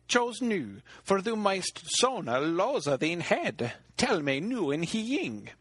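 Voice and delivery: a bargaining hag pressing her price; loathly lady. Acted.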